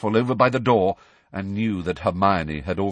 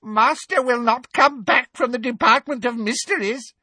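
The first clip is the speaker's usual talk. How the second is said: very high, gloating elf voice; gleeful house-elf; 3 dB louder than usual. A narrator's reading. Elf revealing the master's fate, gleeful. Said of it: "he said gleefully".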